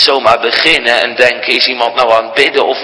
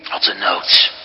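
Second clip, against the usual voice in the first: whisper; 4 semitones lower than usual. Real, not acted. Whispered close.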